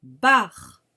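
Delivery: loud, clear dictation of bar; dictée articulée; dictation syllable. Shown as a demonstration. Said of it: "Écrivez la syllabe".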